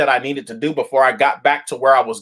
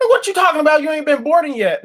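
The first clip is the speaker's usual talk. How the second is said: shocked disbelief; 11 semitones higher, faster than usual. Retold or imitated. Traveler to gate agent, voice jumping up in shock. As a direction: voice jumps up in disbelief.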